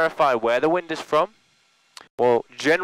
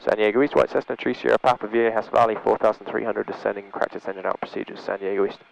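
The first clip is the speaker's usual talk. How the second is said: clipped pilot radio call, lower; 5 semitones lower than usual. Real, not acted. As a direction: read it out like a radio transmission. Instructor makes a radio position call.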